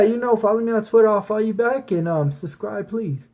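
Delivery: ordinary talk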